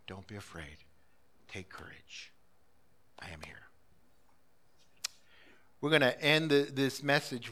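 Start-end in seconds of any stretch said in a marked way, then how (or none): 0.1-3.7 soft, lower whisper of reassurance; whispered comfort. Acted. Jesus softly reassuring his frightened disciples, whispered. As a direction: whisper it like Jesus calming fear.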